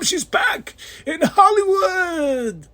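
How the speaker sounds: high, slow drawn-out cry of joy; thrilled fan cheering her return; hyped call. Real, not acted. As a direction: shout it like a ring announcer. Hypes Toni Storm's return.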